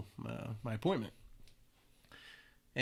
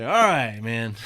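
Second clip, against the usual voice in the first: startled reply; 13 dB louder, slower than usual. Retold or imitated. Startled recruit backs off.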